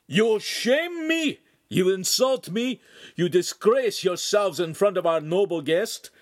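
high, loud theatrical outrage; shouting in character. A narrator's reading. The master's enactor berates the children, shouting. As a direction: berate them at full voice. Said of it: "started shouting in character again".

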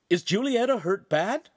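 high-pitched anxious query; worried question. A narrator's reading. Friend asking after the injured woman, high worried question.

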